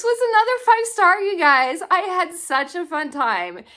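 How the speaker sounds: excited gushing. Real, not acted. Reviewer tells viewers about another five-star read, gushing. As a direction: gush to your viewers, pitch way up.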